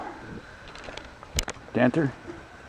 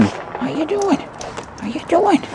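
very high squeaky coo, much higher; squeaky pet voice. Real, not acted. Cooing at Lewis the dog in a very high squeak.

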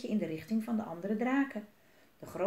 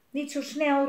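calling out; 6 semitones higher, 6 dB louder than usual. A narrator's reading. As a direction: call after someone walking ahead. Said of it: "riep Elise".

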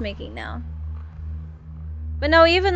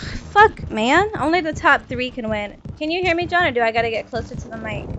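normal talking voice

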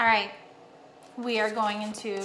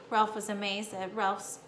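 usual speaking voice

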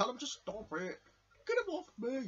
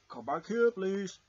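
calling out; 4 semitones lower, 7 dB louder, slower than usual. Acted. Calling Crash back.

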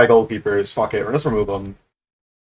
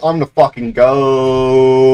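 slow, loud gloating; drawn-out boast; 6 dB louder, slower than usual. Real, not acted. Gamer boasting about being the goat, drawn-out boast. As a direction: stretch GOAT out long and loud.

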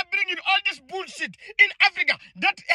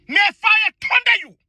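higher, louder curse flung out; pitched-up angry curse; furious curse; 5 semitones higher, 4 dB louder than usual. Real, not acted. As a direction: call down the curse in rage.

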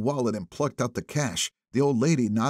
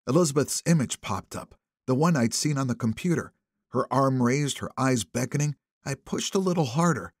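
plain reading voice

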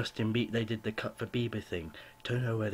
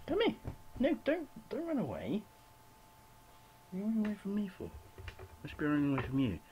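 much higher voice chiding the cat; high pet-talk; 11 semitones higher, faster than usual. Real, not acted. Talking to the cat.

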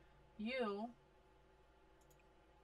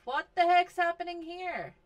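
exasperated; 9 semitones higher, 10 dB louder than usual. Real, not acted. Shocked at dying plants.

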